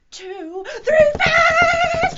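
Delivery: a big cheer to start the fast stomping; fast and excited. Shown as a demonstration. Teacher cheering kids into fast stomping, high excited shout. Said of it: "stomp our feet super duper fast".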